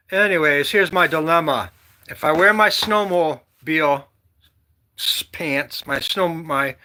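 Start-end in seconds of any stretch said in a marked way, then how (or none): none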